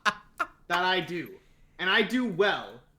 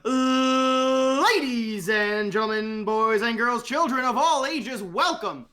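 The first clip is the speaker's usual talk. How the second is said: loud, higher, slow grand announcer voice; ring announcer intro; 5 semitones higher, 4 dB louder than usual. Pretend. Cohost giving a guest a proper grand intro, booming announcer. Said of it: "I have to actually give him a proper intro".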